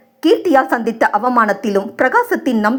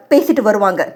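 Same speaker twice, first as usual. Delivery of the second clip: plain reading voice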